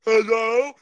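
loud rising hello. Real, not acted. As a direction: call Hello? upward to check the line. Calling out over a bad line.

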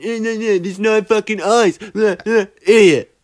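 high-pitched mock rant; mocking co-host impression. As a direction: imitate your co-host's tantrum way up high. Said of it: "a pretty good impression of you".

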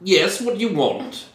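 put-on a fussy young man woken from his nap; irritated young Brit. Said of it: "And he says".

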